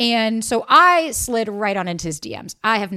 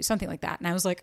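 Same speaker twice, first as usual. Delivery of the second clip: ordinary talk, nothing marked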